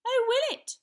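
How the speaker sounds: put-on high, loud boyish challenge; little knight crying out. A narrator's reading. Young knight challenges the giant, crying out. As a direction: fire back high and defiant. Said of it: "cried Sir Scallywag".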